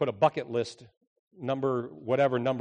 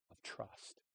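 whisper; 19 dB quieter than usual. Acted. Whispered close.

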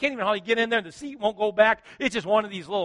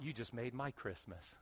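"You just made my Christmas" said softly and low, almost to himself; quiet, moved; 7 semitones lower, 19 dB quieter than usual. Shown as a demonstration. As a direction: let "Christmas" fall away gently, moved inside. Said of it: "And I thought".

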